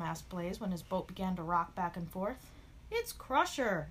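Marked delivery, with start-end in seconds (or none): 2.9-3.9 higher-pitched mild exclamation; exclaiming. A narrator's reading. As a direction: call out the culprit with some alarm. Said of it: "exclaimed Gabby".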